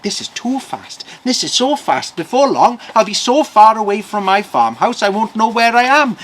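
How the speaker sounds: rising, high-pitched worry; scared farmer. Acted. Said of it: "The farmer started to get scared".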